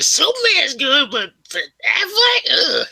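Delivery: slow squeaky imitation of his mom, ending in a disgusted ugh; high mom impression. Son imitating his mom's gripe about Affleck, high and squeaky. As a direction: stretch it out in a high mocking falsetto. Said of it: "She's like".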